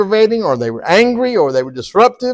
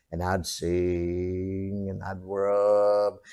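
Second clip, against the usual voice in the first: low bass voice; 8 semitones lower, 8 dB quieter, much slower than usual. Shown as a demonstration. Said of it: "sing at the lowest bass voice you can".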